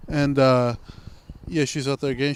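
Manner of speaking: ordinary delivery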